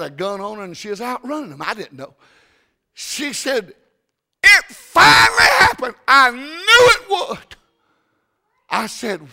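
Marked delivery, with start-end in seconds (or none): 4.4-7.5 high, loud, hammed-up wail; distressed secretary. Preacher voicing the secretary in a hammed-up wail. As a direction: play the panicked secretary.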